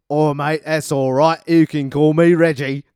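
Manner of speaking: loud, high Cockney geezer; brash Cockney car, shouted; Cockney accent. Acted. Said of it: "a Cockney piece of shit".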